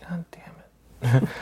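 exhaled, whispered slow curse; a slow unvoiced groan of defeat; weary whispered groan. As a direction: sigh it out under the breath, dragging. Sighing at late call.

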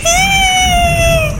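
high, loud terrified shriek; high scream. Acted. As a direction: shriek in terror. Girl falling, screaming.

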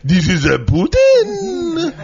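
high, slow, wailing vampire voice; vampire Putin voice. Pretend. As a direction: croon it like a hammy vampire. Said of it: "What is he, a vampire?".

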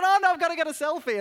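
high, loud and fast fanboy gush; giddy excitement. Retold or imitated. Pastor insisting on a selfie with Cyril, giddy fast gush. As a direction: rush it out like an eager fan. Begging famous man for selfie.

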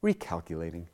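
lightly voiced GPS, low and measured; calm GPS lady. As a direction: calmly announce it like a satnav. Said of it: "lady's voice in the G P S says calmly".

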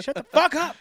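high, loud tantrum shout; inner kid yelling. Acted. Grown man's inner kid at a barking dog, high angry shout. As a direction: let the inner kid lash out. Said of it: "that was the inner little kid in me".

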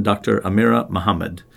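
usual speaking voice

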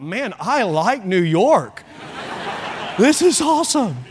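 put-on gleeful, childlike joy at the feast; excited delight. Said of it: "I thought".

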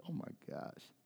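very soft, voiceless dismay; hushed, aching reaction; stunned whisper. Retold or imitated. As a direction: whisper it, overwhelmed. Stunned, tearful reaction retold.

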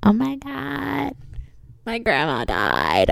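slow, drawn-out fake despair; mock grief. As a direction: overplay a tragic announcement.

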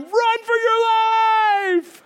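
high, slow, stretched-out crying shout; frantic yell. Retold or imitated. Fleeing boy yelling a warning to everyone, panicked shout. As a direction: wail it out in terror. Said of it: "And I'm sitting there going".

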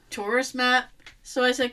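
ordinary talk

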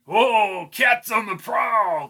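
high, slow cackling leer; snarling with hyena giggles. Acted. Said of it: "said Albie with a hyena laugh".

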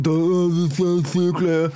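slurred, drawn-out crowd mumble; garbled words dragged out slowly; mock audience mumbling. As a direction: imitate a slow, garbled drunken crowd. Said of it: "de mumler".

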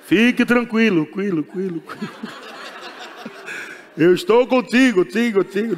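mock reassurance. God voiced, mock reassurance.

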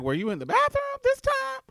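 high loud exasperated question. Retold or imitated. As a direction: ask it high and fed up. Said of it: "the officer was like".

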